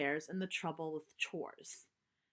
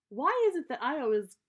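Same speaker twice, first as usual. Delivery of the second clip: complaining; 4 semitones higher, 7 dB louder than usual. Acted. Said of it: "complained Sister".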